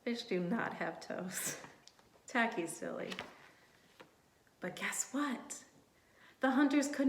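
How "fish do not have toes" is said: usual speaking voice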